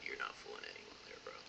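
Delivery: under the breath, mocking; whispered aside. Real, not acted. Telling viewers a disguise fools no one, whispered. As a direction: hush it like a sly aside.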